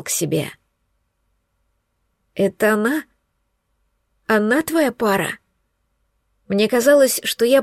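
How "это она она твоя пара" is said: strangled, hoarse question; choked rasp. Acted. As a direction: squeeze it out through a tight throat. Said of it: "сдавленный сип".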